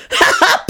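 very high, wild whooping laugh; roaring laugh. A narrator's reading. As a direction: let the laugh explode. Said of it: "roar of laughter".

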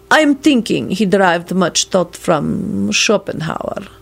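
put-on slow Transylvanian-accented scholar; deliberate delivery in a Transylvanian accent. A narrator's reading. Radu discussing the poet's sources, slow Transylvanian accent. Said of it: "He spoke in his native Transylvanian dialect".

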